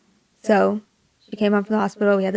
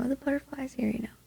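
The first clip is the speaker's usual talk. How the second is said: hushed, much softer voice; soft, half-whispered retold news; talking to a sleeper; 5 semitones higher, 11 dB quieter, faster than usual. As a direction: murmur softly to someone sleeping. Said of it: "kinda talking to her while she's sleeping".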